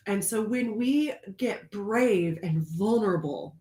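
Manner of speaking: ordinary talk, nothing marked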